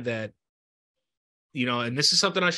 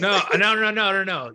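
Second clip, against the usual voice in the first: loud, raised run of denials; hurried reassurance; 6 semitones higher, 6 dB louder than usual. Real, not acted. Host not wanting guest to cry, urgently reassuring. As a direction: jump in quickly, higher and louder.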